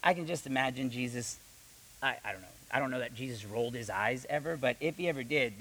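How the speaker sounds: ordinary delivery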